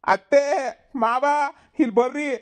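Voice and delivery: high, loud shouts for the elders; calling out loudly. Acted. Cousin shouts for grandma and aunt to come, loud. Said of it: "ಯಾಕೆಲ್ಲ ಕಿರ್ಚ್ಕಂತ್ಯಾ?".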